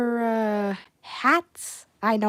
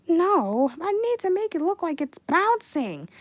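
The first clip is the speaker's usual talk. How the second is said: usual speaking voice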